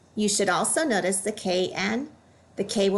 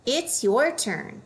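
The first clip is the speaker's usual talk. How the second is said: "your" hit high, slowly; it's YOUR turn, pointing at the student; weight on "your", falling after; stress on your; 4 semitones higher, slower than usual. Shown as a demonstration.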